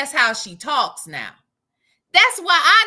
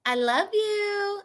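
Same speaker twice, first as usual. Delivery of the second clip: high, slow, drawn-out affection; affectionate; 4 semitones higher, 4 dB quieter, slower than usual. Real, not acted. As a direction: coo it slowly to a friend. Tells a viewer affection.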